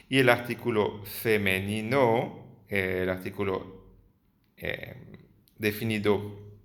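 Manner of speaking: usual speaking voice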